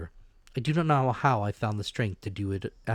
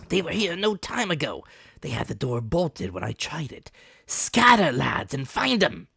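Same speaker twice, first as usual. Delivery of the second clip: shouted orders; 9 semitones higher, 6 dB louder than usual. Acted. Pew ordering crew.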